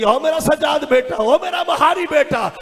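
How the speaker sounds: high, wailing recited lament; impassioned mourner imitating a weeping aunt; tearful. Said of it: "रो के कहती".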